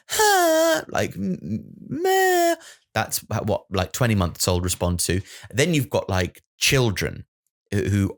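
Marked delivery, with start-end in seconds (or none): 0.1-2.6 squeaky high cooing noises; high baby noises. Shown as a demonstration. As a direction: make little high coos at a toddler. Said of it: "breathless kind of".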